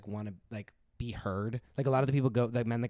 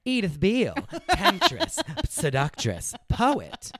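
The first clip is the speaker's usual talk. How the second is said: theatrical, high and loud self-introduction; Drew Barrymore; 7 semitones higher, 6 dB louder, slower than usual. Retold or imitated. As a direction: declaim each title grandly, pitch swooping high. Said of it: "Drew Barrymore in the H B O movie whenever she's like".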